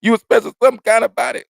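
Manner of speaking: high, loud, quickened jeer; mocking exclamation. Ribbing a man who wouldn't hurt after, mocking. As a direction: call it out high and loud in mockery.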